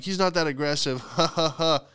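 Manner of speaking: imitating an awkward chuckle; forced chuckle. Dog owner brushing off a complaint, forced mock chuckle. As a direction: say it, then tack on a fake ha-ha-ha. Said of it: "tried to chuckle".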